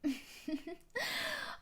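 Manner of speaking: a short, high mocking laugh. Fiancée mocks her weak partner with a mocking laugh. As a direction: laugh down at the weakling.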